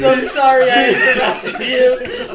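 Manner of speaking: high, loud laughing apology. Real, not acted. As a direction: apologize while cracking up. Laughing apology after interrupting.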